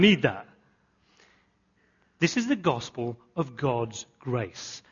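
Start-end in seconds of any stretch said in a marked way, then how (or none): 2.2-4.8 slow and soft, every word weighed; softer solemn declaration; slow emphatic statement. Shown as a demonstration. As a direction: set it down gently, slowly. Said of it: "let me just translate that for you".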